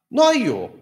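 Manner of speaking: high, loud, dismissive disciples insisting it can't happen; loud mocking "no way" from the disciples; scoffing disciples. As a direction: scoff like doubting disciples sure nothing will grow. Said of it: "弟子たちは".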